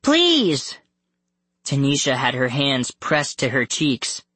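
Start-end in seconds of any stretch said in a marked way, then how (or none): 0.0-0.8 high, drawn plea; high-pitched desperate appeal; begging. A narrator's reading. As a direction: beg as a helpless captive. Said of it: "Scarlet begged".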